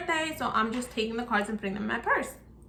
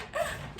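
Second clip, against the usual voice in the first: gasp; 9 dB quieter than usual. Retold or imitated. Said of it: "but I'm like".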